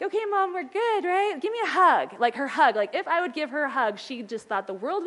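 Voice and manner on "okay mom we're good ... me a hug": put-on high, bright little-girl plea for a hug; daughter pleading. Daughter asking her mom for a hug, in a higher childlike voice. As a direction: coax mom like a small child asking to make up. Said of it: "she'd be like".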